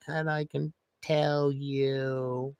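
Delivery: very slow, dragged out; slow motion. Shown as a demonstration. Streamer contrasting normal and slow-motion speech, very slow. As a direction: stretch each word like slow motion. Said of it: "I can talk like I'm in slow motion".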